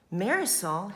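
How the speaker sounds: plain reading voice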